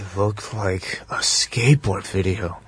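low, slow, hushed deadpan imitation; most serious delivery. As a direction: intone it gravely, like a solemn commentator. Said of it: "like, the most serious delivery".

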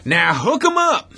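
imitated referee's accent, pitched high; Steve Mazzagatti's accent. Host imitates referee starting a fight, high accented call. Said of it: "in his accent, just would say".

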